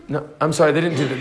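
ordinary talk

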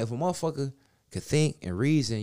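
ordinary talk

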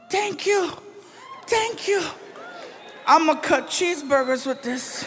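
put-on very high, wobbly drunk thanks; drunk gratitude. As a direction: gush like a wasted kid. Drunk comic thanks for knife.

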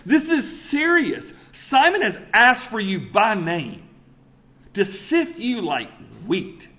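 high, loud, slow, drawn-out warning; urgent warning. Retold or imitated. Jesus warning Simon that Satan is coming, urgent warning.

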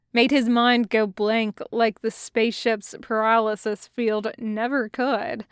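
ordinary delivery

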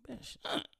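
whispered mock disbelief; incredulous whisper. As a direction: breathe it out in disbelief.

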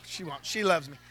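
usual speaking voice

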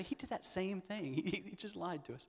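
hushed teasing jab from a viewer; mock viewer aside. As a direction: play a grumbling viewer under your breath, giggling. Mocking viewers of first service.

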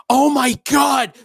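high, slow shouted gasp; excited sister. Retold or imitated. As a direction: blurt it like shocking news. Little sister quoted, excited.

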